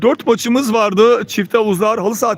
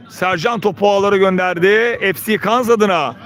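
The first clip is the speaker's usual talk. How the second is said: normal talking voice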